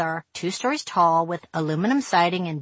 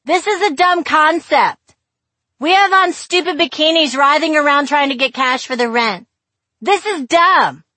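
high, loud shout over the music; 9 semitones higher, 8 dB louder than usual. Retold or imitated. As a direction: yell it over the noise. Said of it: "screaming over some obnoxious music".

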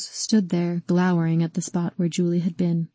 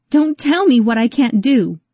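higher and louder angry insistence; angry repeat; 5 semitones higher, 5 dB louder than usual. Acted. Rose defies Calvin, repeating it louder in anger. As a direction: throw it back at him, hotter.

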